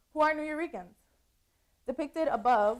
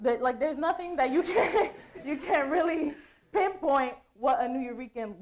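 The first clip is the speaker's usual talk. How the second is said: laughing through it; 4 semitones higher, 5 dB louder than usual. Real, not acted. Laughing at the confusing photo.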